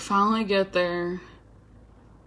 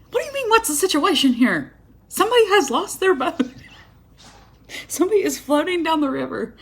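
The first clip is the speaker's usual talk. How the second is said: high, exasperated disbelief; squeaky mock outrage at a clueless stranger; outraged retort; 8 semitones higher than usual. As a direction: throw the question back, indignant and incredulous. Her outraged retort to kayaker.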